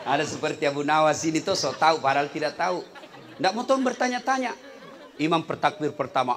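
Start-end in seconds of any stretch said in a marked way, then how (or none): none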